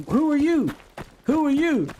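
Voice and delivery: high mock challenge, repeated; high-pitched challenge. Retold stranger's challenge at night.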